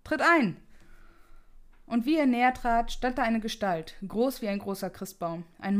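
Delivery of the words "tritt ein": high, loud call from the next room; a ghost's voice calling Scrooge from the next room, loud. A narrator's reading. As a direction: summon him through the wall. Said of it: "eine Stimme ... die ihn rief".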